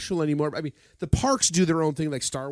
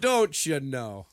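slow mock Canadian accent; drawn-out Canadian don't you know; 3 semitones lower, much slower than usual. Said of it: "a Canadian accent".

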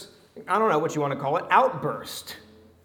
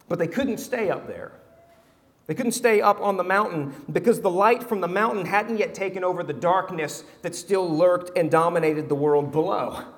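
ordinary talk, nothing marked

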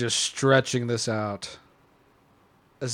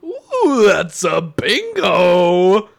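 Landa impression; 6 semitones higher, 8 dB louder, much slower than usual. Quoting Landa's line.

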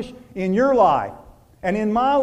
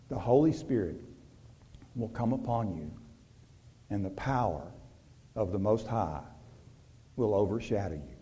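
reverent scripture reading; 10 semitones lower, 12 dB quieter than usual. Acted. Preacher reads Luke to the congregation, hushed and reverent. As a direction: let each phrase fall softly.

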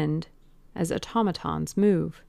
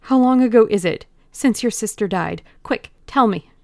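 higher and louder, urgent and breathless; urgent plea; 4 semitones higher, 8 dB louder than usual. Acted. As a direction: demand the answer now, clutching at hope. Dorian seizes a wild hope.

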